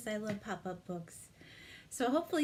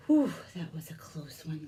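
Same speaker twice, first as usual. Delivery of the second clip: relieved whisper; 5 semitones lower, 6 dB louder than usual. Acted. Librarian sighs with relief to the children, whispering. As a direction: lean in and breathe it out. Relief after close escape.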